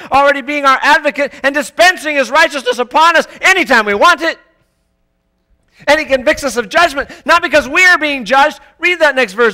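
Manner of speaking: loud, high, driving preacher's voice; fiery preaching. Acted. Preacher pounding the point home to his church, full force. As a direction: preach it hard to the congregation.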